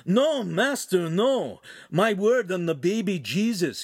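high, drawn-out denial; earnest apprentice protesting. Acted. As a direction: swear your innocence to the master.

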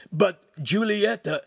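high, slow pleading apprentice voice; timid young apprentice. A narrator's reading. Apprentice begging his master, high and slow.

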